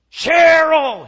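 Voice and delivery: loud, high drawn-out yell of a name; angry scream. Retold or imitated. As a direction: bellow for your wife across a crowded terminal. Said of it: "he kept yelling".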